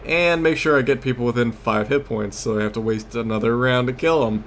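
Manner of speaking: usual speaking voice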